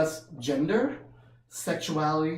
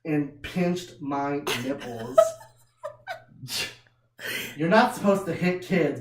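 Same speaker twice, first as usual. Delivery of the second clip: usual speaking voice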